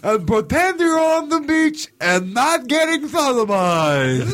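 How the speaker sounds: high, slow creepy clown. Pretend. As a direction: coo it like a predator clown.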